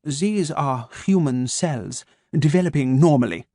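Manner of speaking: put-on slow, crisp German-accented menace; measured German doctor, each word placed; a calm German voice waking his captive. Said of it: "his German accent crisp and sharp".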